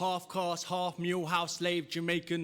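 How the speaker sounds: high, projected rhythmic recital; spoken-word performance. Acted. Poet leading a crowd's call and response, high declaiming. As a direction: declaim it in punchy lines, leaving room for responses.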